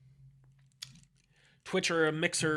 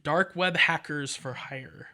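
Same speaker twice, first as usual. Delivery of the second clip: ordinary talk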